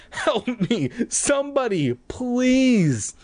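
high, desperate begging; panicked plea. Acted. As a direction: beg in panic. Panicked cry for help.

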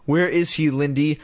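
usual speaking voice

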